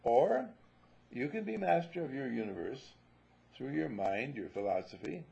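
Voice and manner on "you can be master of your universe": ordinary talk, nothing marked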